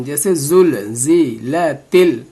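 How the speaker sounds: "زل، زی، ل، تل" as separate measured syllables; syllable-by-syllable demo. Shown as a demonstration. Teacher asking learners to notice, separate careful syllables. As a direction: space out "زل، زی، ل، تل" one by one.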